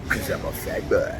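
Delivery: hammed-up self-mocking tag line; mock fat boy voice. Running joke catchphrase, altered voice.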